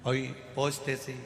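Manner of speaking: ordinary talk, nothing marked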